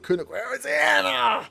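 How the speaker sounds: high, loud cry of dismay; shocked outcry. Real, not acted. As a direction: yell out in disbelief. Opponent scores, shocked outcry.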